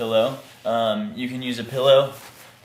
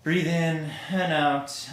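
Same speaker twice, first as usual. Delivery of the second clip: slow and higher, each word drawn out; breath-paced cue; 5 semitones higher, slower than usual. Shown as a demonstration. Teacher pacing students' breathing, slow breath-paced cue. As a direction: stretch the words over one breath in and out.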